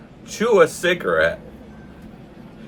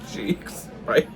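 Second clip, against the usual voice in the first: ordinary delivery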